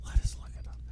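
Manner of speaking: soft whispered aside. Real, not acted. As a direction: whisper it to yourself. Whispered aside while searching.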